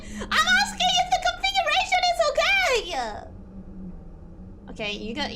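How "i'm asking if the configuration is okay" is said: high laughing shout; indignant protest. Real, not acted. Streamer answering chat's accusation of stalling, indignant high shout.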